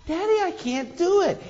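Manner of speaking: put-on high, soft little-kid voice; a small boy giving up; little boy whining. Son voiced in story.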